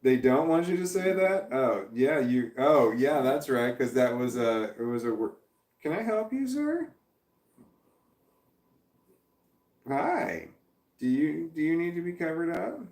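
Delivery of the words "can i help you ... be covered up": high, soft voice for a pet. Real, not acted. Streamer turning to his pet to ask if it needs covering, high and soft. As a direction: coo at your pet.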